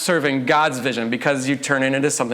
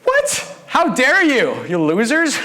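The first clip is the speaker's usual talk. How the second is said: "What?" shrieked very high, then a huffy, scandalized "how dare you"; mock indignant outburst; 9 semitones higher, 3 dB louder, slower than usual. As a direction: play the offended disciples, hammed-up and shocked. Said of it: "Indignant. They're like".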